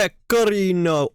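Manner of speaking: Pecorino said high and slow in a mock Italian accent, rolled r; mock Italian Pecorino, drawn out and sung; mock Italian pronunciation. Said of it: "You don't need to make it sound Italianish".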